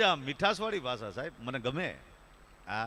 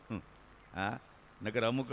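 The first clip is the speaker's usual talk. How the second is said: ordinary talk, nothing marked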